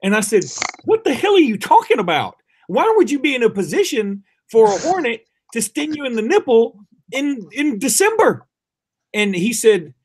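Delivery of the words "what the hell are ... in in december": higher, incredulous retold questioning. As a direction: question it in exaggerated disbelief. Retelling his incredulous reply to dad.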